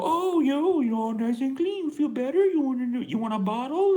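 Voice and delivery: put-on very high, loud cooing baby talk, rising to a squeal; mom baby talk. Video mom cooing to her baby in the sarcophagus. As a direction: gush at the baby like a doting mom. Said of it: "and she's like".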